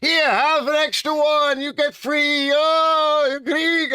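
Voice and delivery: very high, shouting excited shopkeeper; overjoyed restaurant owner shouting in a high voice; excited Greek cook. Retold or imitated. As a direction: gush like a thrilled Greek counterman. Greek owner offers free food.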